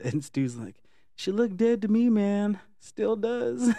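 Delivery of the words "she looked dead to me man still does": high, louder goofy imitation of Stu; deadpan joke in a high silly voice; mock Stu. Quoting Stu's funny line.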